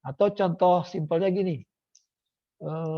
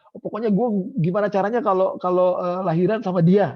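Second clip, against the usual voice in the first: eager client; 5 semitones higher, 7 dB louder than usual. Acted.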